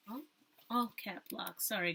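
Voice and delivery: ordinary talk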